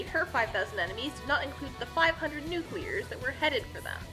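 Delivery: plain reading voice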